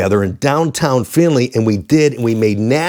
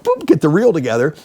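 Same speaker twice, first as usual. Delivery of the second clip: usual speaking voice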